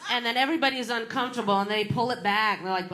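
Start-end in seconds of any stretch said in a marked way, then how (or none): none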